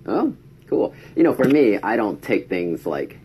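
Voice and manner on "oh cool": high, airy oh cool; fake cheer. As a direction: chirp it brightly. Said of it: "I'm like".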